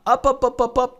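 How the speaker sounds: high staccato run of ups; high, even climbing-price repetition; rapid repeated ups. Shown as a demonstration. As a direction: jump the pitch way up for each quick beat. Mimics price shooting up.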